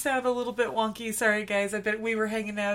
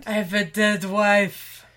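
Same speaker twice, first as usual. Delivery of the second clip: slow Conan voice; 5 dB louder, slower than usual. Retold or imitated.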